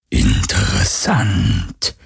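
slow, cold whispered "Interessant"; cold ghostly whisper. Acted. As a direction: draw out "Interessant" in an icy whisper. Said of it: "hörte er eine Stimme flüstern".

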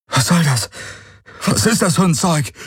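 frightened demand after the sting; panicked. A narrator's reading. Galen gasping, panicked questions. (panisch, höher, erschrocken)

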